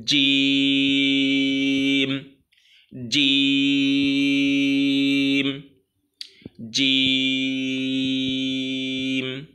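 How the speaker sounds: "জিম" with a hard j and very long level "ee"; firm, long-held pronunciation. Shown as a demonstration. Teacher modelling the letter, firm and very long "জিম". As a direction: hold "জিম" on one steady note, closing on m. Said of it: "শক্ত উচ্চারণ হবে এটার".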